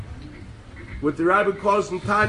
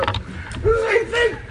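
very high, drawn-out mock shriek; high-pitched, slow indignant cry; exasperated outburst; 14 semitones higher, slower than usual. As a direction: squeal it in disbelief. Exasperated punchline after mock singing.

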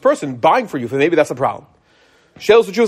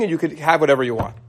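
ordinary talk, nothing marked

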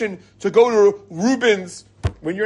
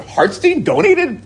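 higher, slow incredulous question; mock disbelief; 9 semitones higher, 4 dB louder, slower than usual. People at shul mocking his donation, incredulous. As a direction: sound amazed that he gave anything. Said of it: "People are gonna look and say".

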